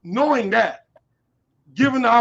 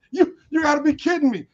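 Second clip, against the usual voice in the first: high-pitched scoffing disbelief; mock incredulity; 6 semitones higher, 3 dB louder than usual. Candidate recalling childhood without AC, mock incredulity. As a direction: scoff as if the idea is absurd.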